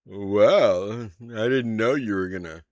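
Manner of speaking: loud, teasing rise on well; playful seductive host voice; flirty NPC. Acted. GM voices NPC greeting player.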